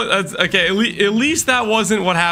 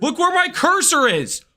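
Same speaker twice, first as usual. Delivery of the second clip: higher, exasperated pleading; 12 semitones higher than usual. Real, not acted. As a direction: look where my cursor is, pleading. Exasperated insisting.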